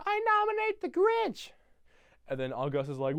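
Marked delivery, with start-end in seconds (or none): 0.1-1.5 put-on high, excited girl's voice; little girl voice. Cindy nominates the Grinch in a high girl's voice. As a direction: squeak it out like Cindy Lou. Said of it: "Cindy nominates the Grinch".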